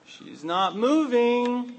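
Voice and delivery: high, slow, exasperated; Martha's disbelief growing; exasperated drawn-out repeat. Acted. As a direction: stretch it out, fed up and disbelieving.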